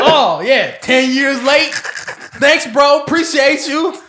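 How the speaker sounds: loud, high mock gratitude; exaggerated, exasperated sarcastic appreciation; sarcastic thanks. Thanking a friend for advice ten years late, loud sarcasm.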